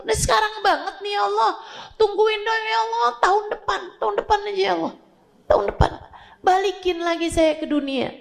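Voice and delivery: high, begging cry of a dying man; desperate plea to God. Acted. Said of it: "dia akan bilang".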